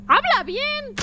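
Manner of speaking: high-pitched exasperated demand; mock scolding. Scolds game character's odd speech.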